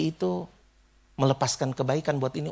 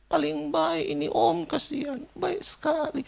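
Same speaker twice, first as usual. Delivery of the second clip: imitated tearful lament; 9 semitones higher, slower than usual. Stranger mourning at the coffin, imitated slow high weeping. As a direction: mimic someone grieving, drawing out each phrase. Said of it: "dalam tangisannya itu dia bilang begini".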